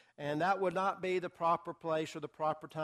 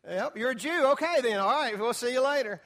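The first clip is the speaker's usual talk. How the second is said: mock dismissive king; 6 semitones higher, 5 dB louder than usual. Teacher plays king dismissing her.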